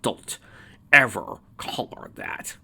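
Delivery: slow, deliberate threat; measured, angry warning; menacing warning. A narrator's reading. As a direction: warn word by word, with pauses. Scowling warning, fist ignited.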